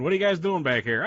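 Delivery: loud, high bossy challenge; stern boss voice. Retold or imitated. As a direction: call it out like a boss. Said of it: "have somebody come in".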